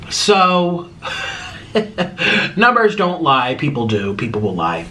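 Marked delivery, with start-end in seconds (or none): 0.1-3.6 says it through a laugh, slowly; amused laughing remark. Real, not acted. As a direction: chuckle out the punchline. Laughing while stating point.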